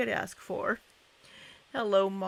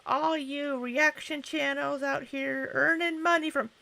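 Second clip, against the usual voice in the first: higher-pitched mocking imitation; imitated griping, raised voice; Amberlynn; 7 semitones higher, 4 dB louder than usual. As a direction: mimic a complainer, pitched up. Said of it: "So now whenever Amberlynn says".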